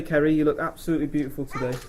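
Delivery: normal talking voice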